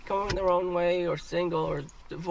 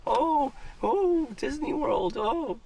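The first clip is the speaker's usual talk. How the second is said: high, gushing mock excitement; mock dreamy gushing; 7 semitones higher than usual. Man mocking women's fairytale marriage dreams, gushing high. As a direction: gush high like a starry-eyed girl.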